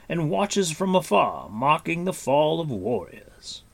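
Gwydion, grave warrior. A narrator's reading. Gwydion answering Taran about the Hunter, grave. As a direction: proclaim it like an old lord.

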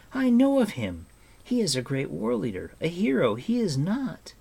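put-on high, impassioned protest from a boy; disbelieving cry. A narrator's reading. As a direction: insist on it, pitch soaring. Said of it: "he cried".

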